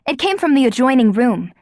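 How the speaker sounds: usual speaking voice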